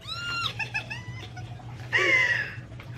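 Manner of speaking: very high squealing laugh; excited squeal. Real, not acted. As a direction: ecstatic high-pitched shriek of laughter. Thrilled at signed books.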